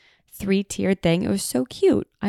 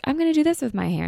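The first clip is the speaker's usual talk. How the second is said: excited hair plan; 9 semitones higher, faster than usual. Retold or imitated. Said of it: "every episode, I'm like".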